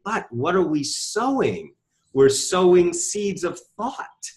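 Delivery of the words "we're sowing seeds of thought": slow emphasis. Real, not acted. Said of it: "emphatic slow point".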